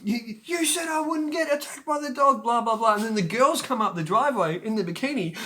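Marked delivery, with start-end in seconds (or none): 0.0-2.9 high, loud tearful outraged scream; crying and screaming. Retold or imitated. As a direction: sob out the betrayal.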